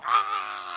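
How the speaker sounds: high, rough, long "ah"; mock death scream. Sherman getting lit up, mock death scream. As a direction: scream like you're riddled with bullets.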